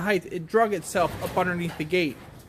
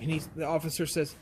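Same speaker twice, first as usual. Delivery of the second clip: ordinary delivery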